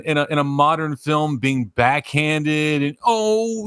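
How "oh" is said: higher-pitched mock-witty aside; sarcastic. Mocking modern film dialogue, sarcastic. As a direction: imitate glib, backhanded movie banter. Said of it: "just very sarcastic".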